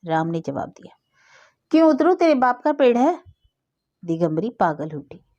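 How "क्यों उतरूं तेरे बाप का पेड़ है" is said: defiant retort. A narrator's reading. Boy talks back from tree.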